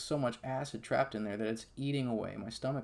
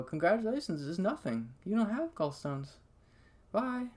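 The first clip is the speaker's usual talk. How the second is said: high-pitched mock congratulations; high, airy send-off in mockery; mock breezy dismissal; 8 semitones higher than usual. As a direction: imitate doctors cheerily brushing a patient off. Retelling doctors' breezy dismissal.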